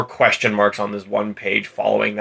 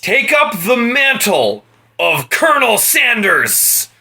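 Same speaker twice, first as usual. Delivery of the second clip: much higher, slow, grandly declaimed; grand proclamation; 10 semitones higher, slower than usual. Retold or imitated. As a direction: declaim it like an epic hero's call. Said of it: "word for fucking word".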